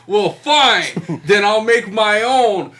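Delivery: loud Bender quote. Retold or imitated. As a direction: shout it through a laugh.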